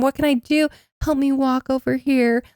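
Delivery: half-hearted imitation of a wounded man asking for help; a touch of a melodramatic plea; mock dramatic Tevin. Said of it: "[name]'s dramatizing it".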